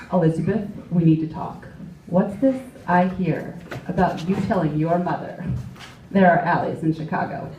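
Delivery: stern dad. Retold or imitated. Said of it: "got a call from my dad".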